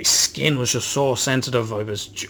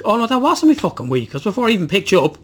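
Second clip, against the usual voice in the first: much higher, louder ranting outburst; exasperated correction; 9 semitones higher, 5 dB louder than usual. Real, not acted. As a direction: burst back into the rant. Suddenly remembers more of the rant.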